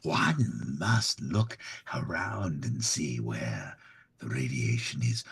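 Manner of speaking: low, slow, measured scientist; Voice of Reason. Acted. Institute persona calmly lecturing the chat, low and slow. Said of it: "Yeah, the Voice of Reason".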